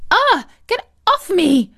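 high, loud shriek of alarm; startled, high-pitched yell; panicked cry. A narrator's reading. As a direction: cry out as if batting something away. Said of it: "The receptionist screamed".

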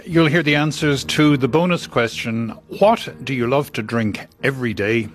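ordinary talk

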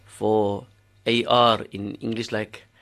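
normal talking voice

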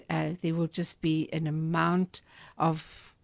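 usual speaking voice

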